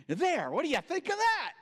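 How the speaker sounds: high, loud and defiant; triumphant challenge. Retold or imitated. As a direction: throw the question down proudly.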